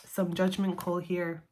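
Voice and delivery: normal talking voice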